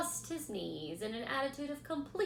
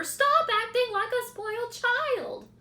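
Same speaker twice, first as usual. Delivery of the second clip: much higher, louder scream; 11 dB louder than usual. A narrator's reading. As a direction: pig yelling at lazy rat. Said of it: "screamed Wilbur".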